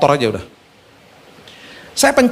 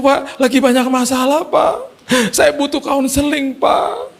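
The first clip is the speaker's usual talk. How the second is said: high-pitched mock crying voice; imitated tearful driver; 9 semitones higher than usual. Driver recognizes the preacher and pleads for counseling, mock crying. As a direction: act out a driver sobbing through his words. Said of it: "Eh, dia nangis".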